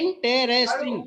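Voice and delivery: "Interesting" broken into four distinct syllables; four separate syllables. Shown as a demonstration. Teacher showing the class the syllable count, "Interesting" said syllable by syllable. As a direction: spell out IN-TE-RES-TING beat by beat. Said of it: "there is a four syllable".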